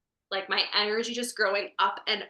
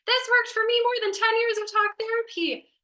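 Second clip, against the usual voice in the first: much higher, louder gushing testimonial voice; testimonial read; 5 dB louder than usual. Retold or imitated. Guest quoting a client testimonial: high, loud gushing read. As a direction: gush like a glowing review. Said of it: "typical story that I would read on your testimonials".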